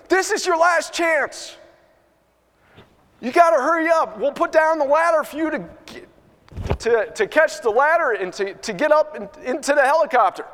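urgent rescuer's plea; urgent warning. Retold or imitated. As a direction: hurry him up, last chance. Helicopter crew urges man.

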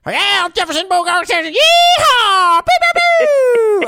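high-pitched whooping cowboy caricature; Jeff Sessions. Pretend. Radio host showing a guest a mock politician impersonation, high loud Texan whooping. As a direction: holler it like a hammed-up Southern yahoo. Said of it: "I do a Jeff Sessions impersonation".

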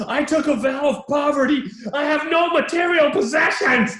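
high, loud hammed-up outburst; angry. Priest rebuffing a homeless man, angrily. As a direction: shout it in mock indignation. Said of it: "angrily, I might say".

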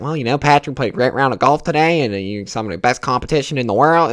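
mock southern clubhouse host; southern accent. Clubhouse host praising Patrick's round of golf, southern accent. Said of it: "he just talks in that southern accent".

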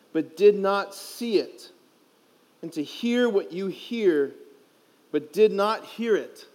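slow, solemn scripture reading; gospel reading. Acted. Priest reading the gospel to the youth, slow and solemn. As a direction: read the gospel slowly and reverently.

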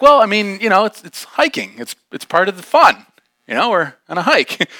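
high, loud, halting reply; coaxing justification. Retold or imitated. Hiker trying to win over the girl, coaxing justification. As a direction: pitch it up, acting out a floundering argument.

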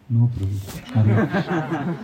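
slow, meek put-on voice with a laugh in it; mock humble refusal. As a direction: drag out the pious refusal. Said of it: "he would always be like this".

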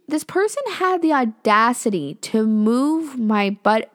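slow, emphatic outrage; indignant. Real, not acted. Teller on the girl who moved the jacket, indignant. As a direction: stretch it out in disbelief.

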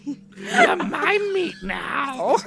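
high, slow, drawn-out creature voice; monstrous captor. Acted. Monster claims Maria in the rafters, slow high creature voice. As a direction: stretch it out as a hammed-up bloodsucker. Acting out a blood-drinker grabbing Maria.

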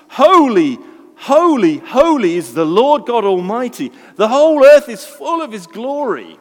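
loud, high, ringing cry of holy; angels crying out. Acted. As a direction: proclaim it like angels calling across heaven. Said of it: "And they cry out".

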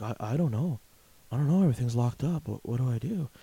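mock panicky cashier, stammering; lightly voiced confused cashier; clueless cashier. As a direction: play a lost, helpless clerk. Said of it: "And they're like".

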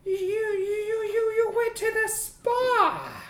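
a flustered boss squeaking out the words; high shocked boss. Acted. Boss to his made-over wife, high flustered stammer.

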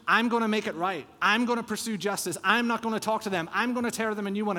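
mock the prideful heart's revenge vow; a hint of a hot-headed avenger; prideful vengeful heart. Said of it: "So what's the action?".

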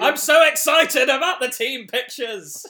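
loud, high, slow gushing; over-the-top excitement. Pretend. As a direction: gush like an overexcited fan. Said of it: "calm down, [name]".

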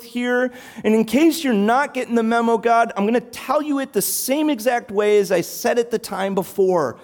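mock the prophet wallowing in self-pity; self-pitying Elijah. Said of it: "Elijah has descended into full-on self-pity".

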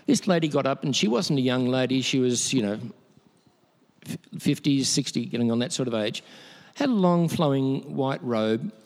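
ordinary talk, nothing marked

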